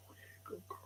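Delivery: hushed whisper of praise. Real, not acted. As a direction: whisper praise to calm the dog. Soothing shy dog.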